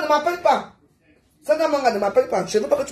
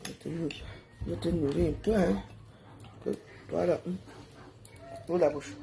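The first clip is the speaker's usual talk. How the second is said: tender; 6 semitones lower, 13 dB quieter than usual. Real, not acted. Softly feeding a small child.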